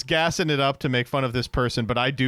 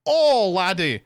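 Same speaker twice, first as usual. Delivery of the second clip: slow, higher, hammed-up Scottish brogue; Scottish Shrek accent; 6 semitones higher, slower than usual. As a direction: put on a thick comic Scottish accent. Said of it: "I watched a lot of Shrek".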